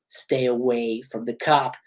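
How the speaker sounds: low, slow, hushed threat; deadly warning. Acted. Ghostface warns Gail off the campus cop, deadly quiet. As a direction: draw it out low and soft. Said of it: "A deadly warning".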